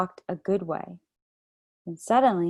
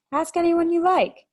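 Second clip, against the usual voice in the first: higher, louder, superior tone; know-it-all; 10 semitones higher, 6 dB louder than usual. A narrator's reading. Edmund showing off to his sister, know-it-all tone. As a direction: sound like you know far more. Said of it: "trying to sound as if he knew".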